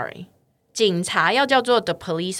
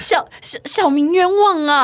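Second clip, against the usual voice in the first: mock desperate plea, higher and louder; pleading commoner; 9 semitones higher, 4 dB louder than usual. Accused commoner begging the magistrate, hammed-up plea. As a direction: cry out your innocence like an opera peasant.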